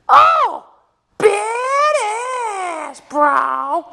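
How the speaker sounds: very high, loud, stretched scream. Real, not acted. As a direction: scream it into the mic, overexcited. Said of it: "Sorry if I screamed into the mic".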